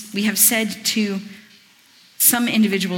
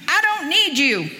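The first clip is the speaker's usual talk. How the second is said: higher, slow dismissive American voice; the eye speaking; 7 semitones higher, 3 dB louder than usual. Acted. Preacher voicing the eye rejecting the hand, slow and dismissive.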